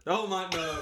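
sudden alarmed outburst; shocked. Real, not acted. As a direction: stop them in alarm. Friend about to say a name.

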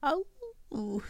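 high-pitched mock shriek; short storybook cry of fright. A narrator's reading. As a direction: shriek it high without full volume. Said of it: "shrieked Flap".

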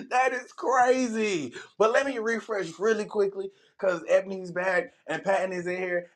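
ordinary delivery

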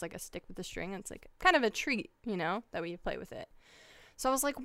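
usual speaking voice

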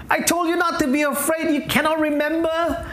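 high-pitched, mildly exasperated reproach; chiding Jesus. Acted. Acts Jesus chiding forgetful disciples.

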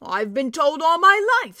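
a hearty dwarf picking up the thread. A narrator's reading. Dwarf character voice.

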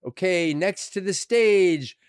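loud, drawn-out ring announcer intro; stage announcer. Retold or imitated.

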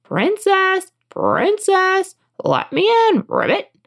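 high, drawn-out frog call. A narrator's reading. Frog at the door calls princess, high calling voice. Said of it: "and then a voice crying".

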